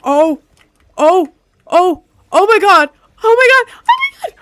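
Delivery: rising shrieks of real shock; excited screaming. Real, not acted.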